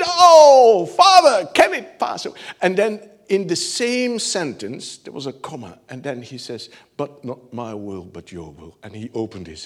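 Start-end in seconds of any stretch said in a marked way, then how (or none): 0.0-2.3 high, loud shout of anguish. Retold or imitated. Jesus shouting to the Father in Gethsemane, high and loud. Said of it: "he was shouting". 7.0-8.8 soft, low surrender; quiet surrender. Retold or imitated. Jesus yielding to the Father quietly, softly and low.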